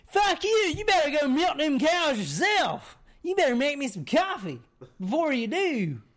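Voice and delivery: high, loud retort, imitating a quarrel; mock angry husband. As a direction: throw the order right back, hammed-up. Retold drunk husband snaps back.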